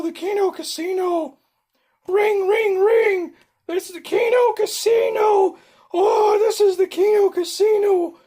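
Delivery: very high, loud shouted caricature voice; P P P impression. Streamer imitating P P P for chat, in a very high, loud put-on voice. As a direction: crank the pitch way up and yell every line like a frantic casino host. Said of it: "Let me see if I can do P P P".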